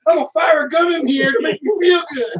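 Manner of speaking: high, loud hammed-up gun-nut shout. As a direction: holler it like a reckless American. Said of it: "In America, we're just like".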